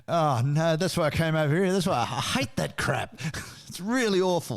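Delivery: mock Aussie complaint. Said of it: "She didn't say".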